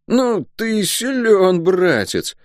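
high, drawn-out amazement; awed, high-pitched drawl; respectful disbelief. A narrator's reading. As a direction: stretch it out, impressed and doubtful. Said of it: "Уважительно и несколько недоверчиво протянул Лук".

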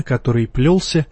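ordinary delivery

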